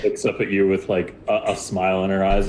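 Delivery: ordinary delivery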